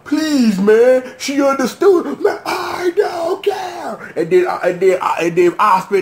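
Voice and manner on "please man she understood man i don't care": mock pleading argument. Acted quarrel between characters.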